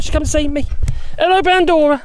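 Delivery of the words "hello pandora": high, louder, sing-song hello to the dog; dog greeting. Real, not acted. Gardener greeting his excited dog Pandora, high sing-song. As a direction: greet the dog like a delighted owner.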